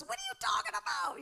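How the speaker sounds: very high, fast, laughing mock of a baffled husband. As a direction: squeak out the husband's confusion through a laugh. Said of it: "He goes".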